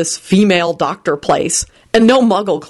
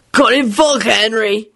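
higher-pitched mumble; mumbled request; 4 semitones higher than usual. A narrator's reading. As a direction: mumble it as a plea for a drink. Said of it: "he mumbled".

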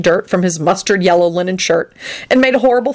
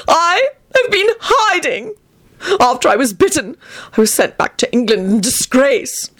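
high, melodramatic woe; anguished cry; 9 semitones higher than usual. Acted. Antonin recounts his disgrace, high theatrical anguish. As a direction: lament it with hammed-up suffering. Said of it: "Antonin cried".

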